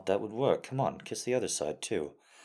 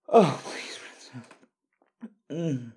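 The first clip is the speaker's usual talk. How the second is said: desperate pleading; 4 semitones higher, 6 dB louder than usual. Acted. Escalating pleading.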